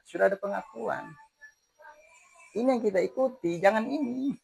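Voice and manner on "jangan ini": ordinary delivery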